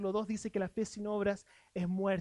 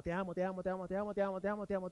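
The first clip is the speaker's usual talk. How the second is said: soft, lower, tender I love you; tender repetition; 4 semitones lower, 6 dB quieter, much faster than usual. Retold or imitated. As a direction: murmur it tenderly to your wife. Voicing repeated love words to wife. (tierno y suave, más grave)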